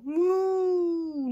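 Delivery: high, long mock moo; cow moo. A narrator's reading. Cow answering cat, high long moo. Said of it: "mooed Cow".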